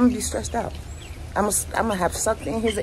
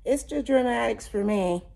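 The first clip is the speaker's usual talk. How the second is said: sassy; 6 semitones higher than usual. Real, not acted. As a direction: throw it out with attitude. Repeats phrase louder higher.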